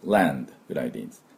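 ランド said low, soft and fast; quick quiet ランド; light unstressed land. Shown as a demonstration. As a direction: throw away the land, barely touched. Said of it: "あんまり強く言わなくて".

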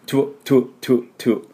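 "トゥ" as short tw bursts; quick isolated "トゥ" drills; double consonant. Shown as a demonstration. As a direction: puff out each "トゥ" with t and w fused. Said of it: "ダブルシーンがポイントですね。".

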